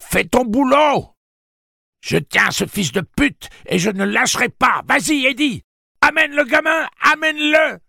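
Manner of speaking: loud, piercingly high yelling; screamed orders. A narrator's reading. Susanna holding the demon and ordering Eddie on, screaming high. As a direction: scream commands through a fierce struggle.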